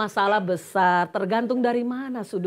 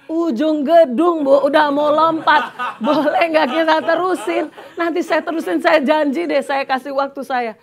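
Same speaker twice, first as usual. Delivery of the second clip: high, loud, breathless plea with nervous laughs; urgent pleading; 7 semitones higher, 9 dB louder than usual. Retold or imitated. Speaker begging the offended woman to wait, urgent and loud. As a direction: beg for time, voice pitched up and pushed.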